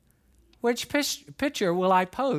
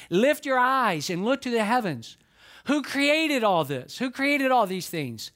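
scripture reading; 4 semitones higher, slower than usual. Acted. Reads verse with emphasis.

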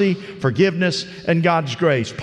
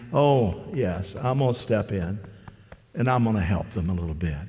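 smug mock voice; 7 semitones lower, 6 dB quieter than usual. Voicing a smug helper.

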